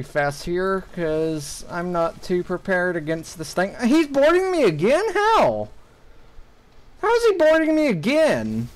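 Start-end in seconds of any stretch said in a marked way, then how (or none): 3.9-8.7 high-pitched, exasperated disbelief; real indignant surprise, pitch jumping up; incredulous outburst. Real, not acted. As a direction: cry out as if you can't believe it. Boarded again mid-fight, in disbelief.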